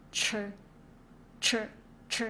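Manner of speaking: each CH short, with a buzzing vowel after it; voiced CH. Shown as a demonstration. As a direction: say CH with vocal cord vibration, separately each time. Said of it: "Feel the vibration on your vocal cord".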